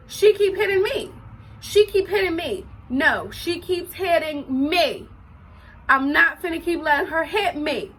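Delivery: retold indignant objection; indignant protest. Arguing back to mom in retold fight.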